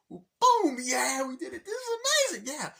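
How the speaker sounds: very high, loud victory yell; excited shout. Retold or imitated. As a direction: scream the win at full volume. Brothers celebrate, shouting.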